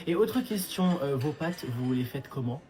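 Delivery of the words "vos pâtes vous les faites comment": normal talking voice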